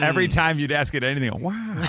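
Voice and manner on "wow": soft drawn-out wow; Owen Wilson wow. Retold or imitated. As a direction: breathe out a dazed wow. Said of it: "Owen Wilson".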